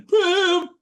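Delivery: high, loud cry of the name; exasperated yell. Acted. Lawyer spotting the murderer, exasperated yell. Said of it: "yells out in exasperation".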